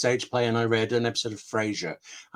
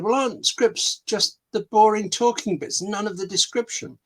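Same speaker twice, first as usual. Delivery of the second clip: higher-pitched, needling question; mock dismissive novelist; 10 semitones higher than usual. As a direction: lightly imitate a novelist pooh-poohing it. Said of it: "And a novelist said".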